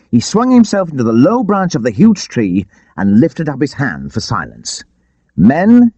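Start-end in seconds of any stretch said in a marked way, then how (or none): 5.4-5.9 high, loud call to the crowd. A narrator's reading. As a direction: summon the outlaws from the branch. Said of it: "he cried".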